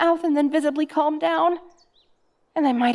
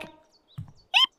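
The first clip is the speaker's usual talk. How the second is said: a sharp, high squeak of fright; sudden high-pitched yelp when touched on the shoulder; startled yelp. Real, not acted. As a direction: jump out of your skin with a tiny squeak. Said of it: "I just yelped".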